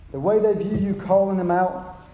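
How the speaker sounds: usual speaking voice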